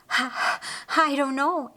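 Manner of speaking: high, breathless stammer; gasping breaths. Acted. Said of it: "still gasping breaths".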